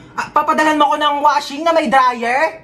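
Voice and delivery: ordinary talk, nothing marked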